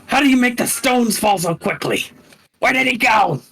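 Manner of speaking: hunters searching for prey that has vanished; confused monster voice, pitched well up; baffled creature. Acted. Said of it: "suddenly they're like".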